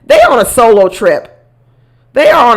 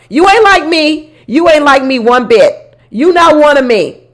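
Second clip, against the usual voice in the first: indignant retort; 6 semitones higher, slower than usual. Real, not acted. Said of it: "talking back to Michelle".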